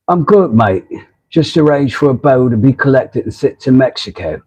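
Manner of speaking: badly faked English accent; terrible British accent. Reading viewer Rob's comment back in his accent, terrible British accent. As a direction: do a poor imitation of a British accent. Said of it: "Terrible accent".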